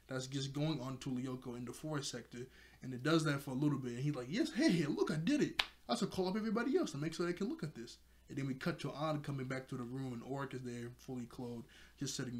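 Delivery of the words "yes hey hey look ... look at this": high, eager mock triumph; lightly hammed-up glee; imitated excitement. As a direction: act out an excited discovery, pitch up. Acts out Jeremy's triumph.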